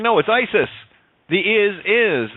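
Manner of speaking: "isis" then "The is, is" split slowly into two syllables; spelled-out pronunciation. Shown as a demonstration. Revealing the hidden word to listeners, slow and separated. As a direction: pull "is, is" apart slowly, each one stressed. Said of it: "I S S is what?".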